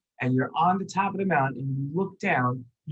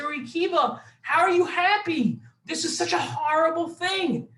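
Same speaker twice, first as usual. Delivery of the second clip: upset friends; 12 semitones higher than usual. Retold or imitated. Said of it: "And say".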